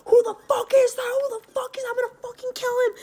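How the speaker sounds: high-pitched imitation of stepdad screaming; retold furious screaming. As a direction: scream it like an enraged stepdad charging over.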